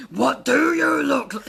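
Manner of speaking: loud, high dinosaur demand; unloving bellow. Acted. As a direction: bellow the question with no tenderness. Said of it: "just try and be loving".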